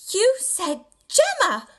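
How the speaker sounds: put-on high, slow, scolding child; admonishing little girl. A narrator's reading. Little sister scolds the storyteller, high and indignant. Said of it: "she admonished".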